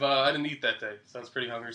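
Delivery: ordinary talk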